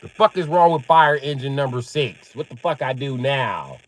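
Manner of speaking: usual speaking voice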